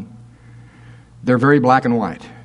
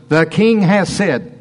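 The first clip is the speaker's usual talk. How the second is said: the king has said, slow, king pressed hard; stress on king; slower than usual. Shown as a demonstration. Preacher showing the captain's claim of authority, stressed. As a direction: lean on king like a royal decree. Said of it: "this is the word of authority".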